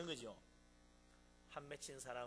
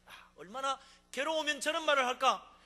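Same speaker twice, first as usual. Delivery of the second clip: loud sympathetic exclamation; 9 semitones higher, 13 dB louder than usual. Acted. Compassionate listener understands sufferer.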